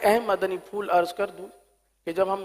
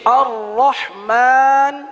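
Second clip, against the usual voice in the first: Quran recitation; 4 semitones higher, much slower than usual. Shown as a demonstration. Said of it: "teacher recites for students".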